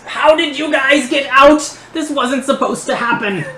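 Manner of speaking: high, loud, panicked outburst; frightened villain swearing in dismay, pitch jumping up; panicked gnome. Acted. Gnome to his escaped captives as he trips backwards, panicked. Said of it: "just swearing as he goes".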